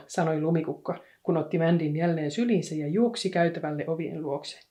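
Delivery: usual speaking voice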